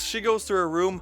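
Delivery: ordinary delivery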